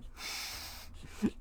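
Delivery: soft, voiceless wheeze of laughter; wheezing laugh. Real, not acted. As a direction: laugh with no voice, just air. Laughs at own impression.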